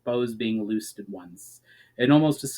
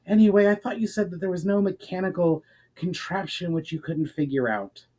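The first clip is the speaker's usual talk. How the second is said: snapped retort; 7 semitones higher than usual. A narrator's reading. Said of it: "The second companion snapped in retort, still quite out of breath".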